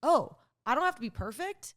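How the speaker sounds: the penny drops with joy; delighted realization. Retold or imitated. Retold epiphany.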